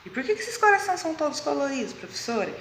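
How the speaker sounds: voz mais aguda de criança perguntando; aluno curioso. Acted. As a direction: pergunte como um aluno à professora. Imita aluno perguntando à professora. (voz de aluno curioso)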